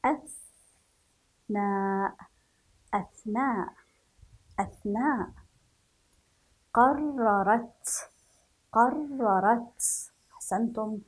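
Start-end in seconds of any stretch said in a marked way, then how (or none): 0.0-2.2 "أثناء" drawn out very slowly, the ث held with a stop; slow model word. Shown as a demonstration. Teacher shows the silent ث to children, slowly. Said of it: "لاحظوا كيف نطقنا حرف الثاء". 6.7-10.1 "قررت" very slow, with the doubled راء held; slow model word. Shown as a demonstration. Teacher demonstrates the shadda on raa, slow and firm. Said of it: "الحرف المشدد".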